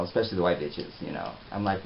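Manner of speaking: usual speaking voice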